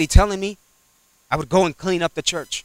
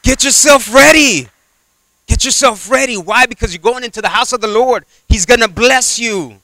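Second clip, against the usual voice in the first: full-force preaching; 7 semitones higher, 4 dB louder than usual. Acted. Preacher urging the congregation, full-force preaching. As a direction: exhort the church, ringing out.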